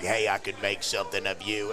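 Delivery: gruff instructor. Retold or imitated. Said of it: "That motherfucker was like".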